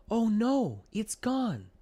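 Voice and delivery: high, loud cry of dismay. A narrator's reading. Milton crying out as the bubble pops, dismayed. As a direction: gasp as the light vanishes.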